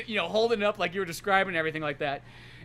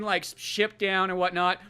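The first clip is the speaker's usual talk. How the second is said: usual speaking voice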